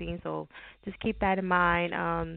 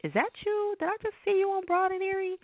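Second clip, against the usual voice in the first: excited caller; 13 semitones higher than usual. Retold or imitated. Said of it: "random phone calls".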